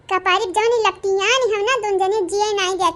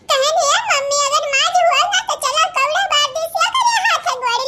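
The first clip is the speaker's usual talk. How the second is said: put-on much higher, giggling child voice; laughing child voice; 5 semitones higher than usual. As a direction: squeal it through giggles like a kid.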